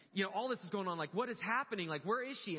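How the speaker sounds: high, loud, frantic questioning; panicked reliving, voice pushed high and loud; freaking out. Retold or imitated. Father driving home not knowing where his daughter is, freaking out. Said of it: "I'm, like, freaking out".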